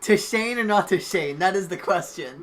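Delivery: loud, slow, booming mock announcer; dramatic announcer. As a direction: proclaim it like a theatrical narrator.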